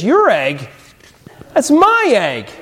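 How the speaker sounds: snatch the claim back, offended. Acted. Lazy bird claiming Horton's egg, high and indignant.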